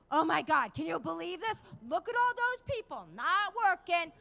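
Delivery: high, loud mock outrage; husband impression. Wife mimicking her husband in security line, loud exasperated rant. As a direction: imitate a complainer ranting in a queue. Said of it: "Vinny starts with his".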